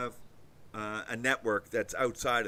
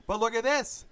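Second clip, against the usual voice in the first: rising excited repeat; 12 semitones higher, 5 dB louder than usual. Acted. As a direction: pitch jumps higher, a bit louder. Repeats line, then rising.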